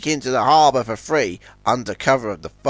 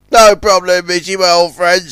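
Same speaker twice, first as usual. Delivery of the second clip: louder, higher, eager reply; eager Zoran; 4 semitones higher, 7 dB louder than usual. A narrator's reading. Zoran gladly agrees to help Izzy, eager. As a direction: agree all too readily. Frightened Zoran welcomes company.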